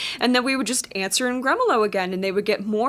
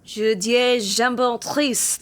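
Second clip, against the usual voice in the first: slow, earnest fake French; fake French gibberish; much slower than usual. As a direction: say the nonsense French sincerely. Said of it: "sounded like a bunch of nonsense".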